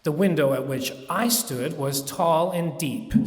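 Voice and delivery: usual speaking voice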